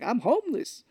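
high-pitched mock plaint; lightly hammed-up outburst of self-pity; mock desperate. Robin Williams's character begs Jon Stewart for help, mock desperate. Retelling film character's complaint.

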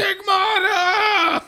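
high, long-drawn theatrical cry; mock wailing shout. Tama stabbing Andy's hand, mock dramatic shout. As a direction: stretch it into a hammed-up wail.

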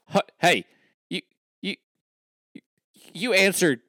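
high, loud, stammering disbelief; comically flabbergasted father; baffled dad. Acted. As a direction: sputter in exaggerated confusion, halting between words. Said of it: "he's so confused".

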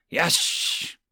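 unvoiced dragon hiss. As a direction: hiss the word out. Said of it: "Quick hissed".

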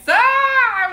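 high, loud burst of glee at grandma; delighted shriek. Retold or imitated. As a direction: shriek it like kids who cracked up. Family cracks up laughing.

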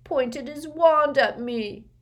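mumbled. Acted. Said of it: "Dudley mumbled".

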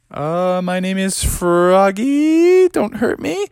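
high, drawn-out timid plea; hesitate, then squeak up in fear; acted scared little voice, rising high; nervous. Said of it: "started to get kinda nervous".

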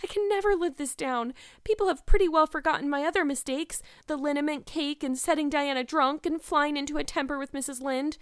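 high, loud, despairing lament; tearful. A narrator's reading. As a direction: wail about lasting disgrace. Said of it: "questioned Anne in tears".

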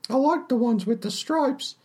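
barmaid voice. Acted. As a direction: play the friendly, simple barmaid.

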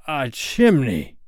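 slow, high-pitched astonishment; exclaiming. A narrator's reading. Both pirates discovering the chimney, high exclamation. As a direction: draw out the startled discovery. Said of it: "they both exclaimed".

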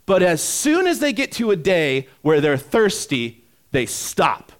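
loud, high-pitched sermon delivery; animated preacher painting a scene; impassioned preaching. Real, not acted. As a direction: preach it with rising energy. Preaches at full force.

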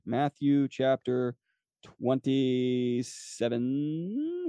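drawn-out words while finding the page; very slow, held syllables, mind on searching; searching aloud. Real, not acted. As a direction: stretch it out as you look up the verse. Looking up the verse aloud.

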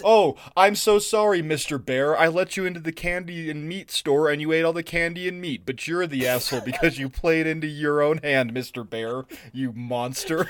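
exaggerated fake sorry, building into an accusation; mock apology. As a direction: scold a friend through a mock apology. Sarcastic apology to a friend.

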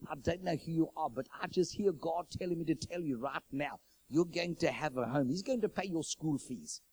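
put-on amateur South African accent; bad South African accent. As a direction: imitate a South African prophet, badly. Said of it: "that's probably a bad South African accent".

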